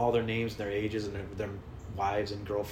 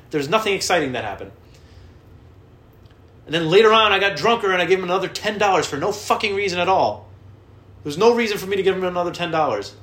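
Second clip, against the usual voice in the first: loud, higher-pitched self-directed tirade; exasperated rant; 8 semitones higher, 9 dB louder than usual. Real, not acted. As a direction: rail at your own pointless story. Mock rant at own pointless story.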